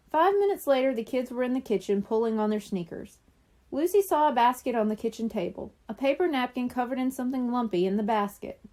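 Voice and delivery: usual speaking voice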